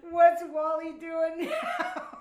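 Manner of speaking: loud, slow laughing exclamation; excited shout. Retold or imitated. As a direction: shout it through laughter, amazed. Friends react to limo arrival.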